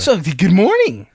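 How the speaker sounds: high, loud fake-cheerful greeting; comedic high voice. Mocking an overly cheerful server, high comic greeting. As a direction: pitch it up to a cartoonish level. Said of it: "raising the pitch of my voice to some comedic level".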